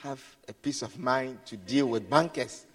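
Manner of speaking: soft, gentle telling-off; quiet scolding. Retold or imitated. Preacher gently reminding children their mother is busy, softly. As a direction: reason with them quietly. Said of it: "And I said".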